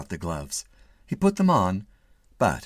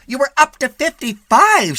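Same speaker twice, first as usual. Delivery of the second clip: nagging wife; 11 dB louder than usual. A narrator's reading. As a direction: scold him from the passenger seat. Wife scolds.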